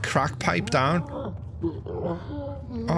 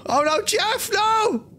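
panicked shout; slower than usual. Real, not acted. Baby about to die in game.

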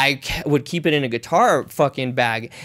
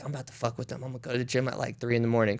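scheming under breath; 2 semitones lower, 12 dB quieter, much faster than usual. Retold or imitated. Scheming plan told to himself.

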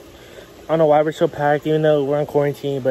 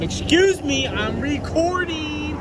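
high, drawn-out call for help; high-pitched insistent calling; 13 semitones higher, 4 dB quieter, slower than usual. Real, not acted. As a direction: holler high to someone far away. Calling for a towel from pool.

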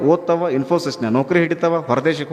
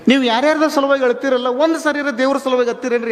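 higher, ringing sermon exhortation; fervent preaching; 8 semitones higher than usual. Acted. Preacher to the gathered devotees, higher-pitched fervent exhortation. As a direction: challenge the crowd from the pulpit.

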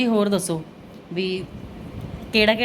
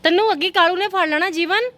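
loud warning; 12 semitones higher, 5 dB louder than usual. Real, not acted. Warning child about street dog.

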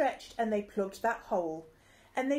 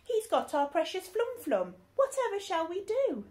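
panicked; 9 semitones higher than usual. Acted. Crew panicking over theft.

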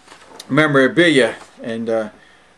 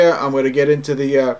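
ordinary talk, nothing marked

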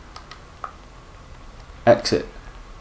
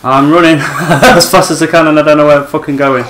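yelling while running away; 4 semitones higher, 5 dB louder than usual. Real, not acted. Fleeing creature, yelling.

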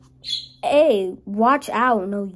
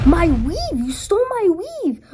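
shocked outrage; 9 semitones higher, 6 dB louder than usual. Acted. Character outraged over stolen weave.

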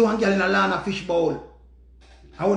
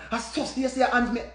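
ordinary delivery

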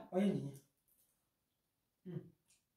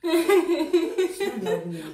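laughing; 9 semitones higher, 4 dB louder, slower than usual. Real, not acted. Laughing through burning mouth.

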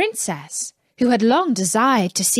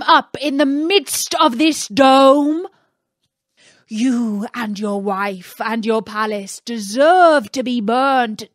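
angry genie; 3 semitones higher, 4 dB louder than usual. Acted. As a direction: scold Aladdin as the outraged genie. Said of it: "loud and terrible shriek".

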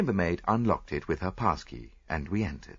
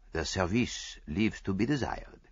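put-on understated, dry accented remark; dry observation. A narrator's reading. Detective remarks on the dusty room, mildly dry. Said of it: "he observed dryly".

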